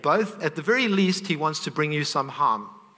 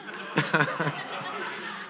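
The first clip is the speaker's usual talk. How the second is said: breathy laugh; 5 dB quieter than usual. Real, not acted. Laughs at crying over God.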